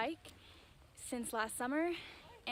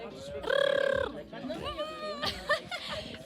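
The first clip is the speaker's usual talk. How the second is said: burst of laughter. Real, not acted. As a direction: crack up in disbelief. Laughing at rolled R lesson.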